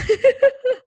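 high, bright burst of laughter; a short real laugh. Real, not acted. Laughing at her own admission.

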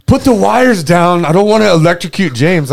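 retold panicked cry, high and loud; mock panic. Friend balks at dropping the live wires, mock panic. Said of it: "a little bit panicked".

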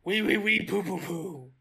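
put-on silly French accent babble; a jokey fake French lilt; mock French. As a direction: goof on French as gibberish. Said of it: "Oh, in French".

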